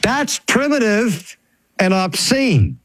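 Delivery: stretched, high-pitched imitation of an outburst; retold heckler's shout. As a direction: imitate a heckler calling from the back. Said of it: "shouted out spontaneously from the back".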